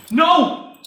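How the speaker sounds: high, loud shouted refusal; roaring defiant reply; crowd's shout. Acted. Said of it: "Came the shout".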